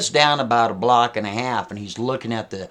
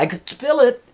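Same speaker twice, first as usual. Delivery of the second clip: higher, louder teasing threat; higher, louder mock taunt; 12 semitones higher, 6 dB louder than usual. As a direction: dangle the threat in a mocking singsong. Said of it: "She's like".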